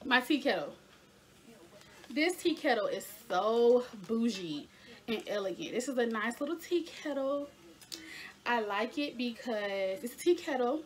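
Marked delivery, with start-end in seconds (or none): none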